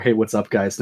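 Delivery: YouTuber British accent. Shown as a demonstration. Showing friends his British YouTuber accent starter. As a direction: open like a British YouTuber, lightly. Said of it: "I can't do a British accent without starting with".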